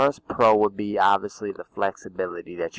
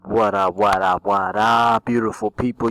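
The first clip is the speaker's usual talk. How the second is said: hype greeting; 5 dB louder than usual. Acted.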